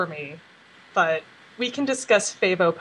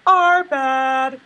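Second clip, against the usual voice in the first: louder, breezy throwaway apology; flippant apology; 6 semitones higher, 6 dB louder than usual. Retold or imitated. Ponies brushing off stolen cake, flippant apology. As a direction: toss it off without caring. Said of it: "just saying".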